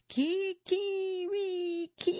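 long high-pitched slow crow; a drawn-out cock's crow, held high; rooster crow. A narrator's reading. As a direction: stretch it out like a proud rooster. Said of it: "he sang".

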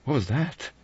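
high, startled question; exclaiming. A narrator's reading. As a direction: jump on it in sudden alarm. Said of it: "She exclaimed".

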